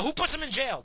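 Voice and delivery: asking angrily, not yet at full yell; angry question. Real, not acted. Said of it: "Yeah, I'm worked up".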